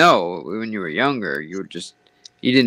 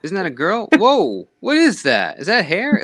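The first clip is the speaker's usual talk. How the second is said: put-on shocked teen; 7 semitones higher than usual. Teen seeing hairy armpit.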